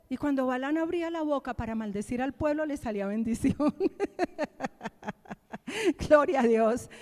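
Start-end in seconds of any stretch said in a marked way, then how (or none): none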